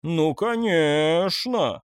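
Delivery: slow, stretched sarcastic drawl; drawn-out sarcasm. A narrator's reading. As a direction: drag it out in disbelief. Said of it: "протянул Густав". (протяжно и саркастично)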